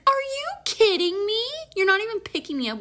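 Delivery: high, loud affronted question; indignant disbelief. Retold or imitated. Woman to boyfriend downgrading the date, indignant.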